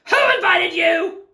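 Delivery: loud, high challenge; indignant. Acted. Cactus to uninvited lizard, loudly indignant. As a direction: demand it crossly.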